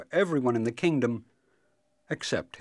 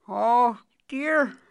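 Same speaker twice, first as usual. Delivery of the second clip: high, louder wizardly dismay; 11 dB louder than usual. Acted. Wizard sees the queen turned into rose bush, dismayed. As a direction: pipe up in dismay, high-voiced. Wizard's spell has gone wrong.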